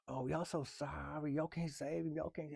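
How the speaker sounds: mild mocking whine; mock sympathy. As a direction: sneer at their fake sorrow.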